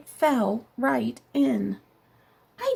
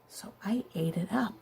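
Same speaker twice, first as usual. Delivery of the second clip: soft whispered confession; 11 dB quieter than usual. Acted. Wolf admits to the class he ate the pig, whispered. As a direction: whisper it like a guilty secret. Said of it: "whispered confession".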